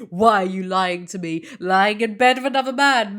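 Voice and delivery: mock dramatic reading. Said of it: "Man is a wordsmith".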